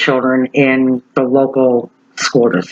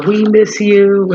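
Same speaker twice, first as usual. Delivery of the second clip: higher, slow, sweet "we miss you"; tender sign quote; 6 semitones higher, much slower than usual. Retold or imitated. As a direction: read it like a heartfelt homemade sign. Quoting teachers' homemade sign.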